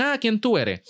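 high, bright imitation of someone asking; mock interviewer. Host imitating an interviewer asking who you are, mock high. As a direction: play the person asking him. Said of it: "te preguntan".

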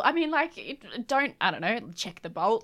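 ordinary talk

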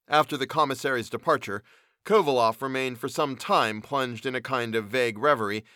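usual speaking voice

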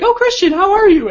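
very high, sing-out hello; exaggerated high-pitched breezy impression; mock breezy greeting. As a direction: greet him sky-high as if nothing's wrong. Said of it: "this is a perfectly normal thing".

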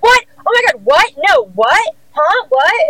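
high-pitched, incredulous imitation of disbelief; hammed-up startled questions, jumping up in pitch; mock shock. Producer reacting to news she's off the show, mock shock.

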